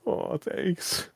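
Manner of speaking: a dry, half-whispered thank you; sarcastic thanks. Retold or imitated. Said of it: "I was like".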